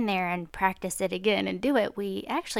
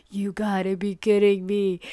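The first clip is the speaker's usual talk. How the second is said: retold fed-up parent; mild exasperation, as if in disbelief; exasperated disbelief; 3 dB louder than usual. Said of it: "super frustrated".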